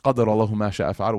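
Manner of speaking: fast, low recited supplication; Arabic recitation. Acted. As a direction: recite the dua swiftly.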